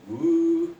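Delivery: high swooping "woo"; imitated pint downing. Comedian miming the first pint, swooping woo. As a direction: swoop up high for a drink going down.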